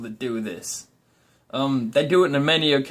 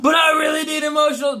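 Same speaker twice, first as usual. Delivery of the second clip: whiny plea; 11 semitones higher than usual. Retold or imitated. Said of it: "your significant other's like".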